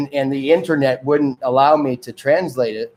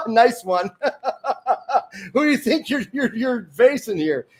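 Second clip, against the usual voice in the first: scoffing through laughter, pitched up; mocking laughter; laughing; 11 semitones higher than usual. Real, not acted. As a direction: laugh off the excuse. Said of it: "laughing scornfully".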